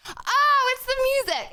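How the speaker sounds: high, sudden realization; surprised realization. Real, not acted. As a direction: it just clicked, surprised. Sudden realization about noise.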